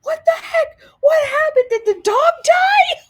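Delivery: loud, very high shriek of alarm; hammed-up panicked squeal, half laughing; Libby. Friend alarmed at the tears, imitated shrill shout. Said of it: "And Libby's like".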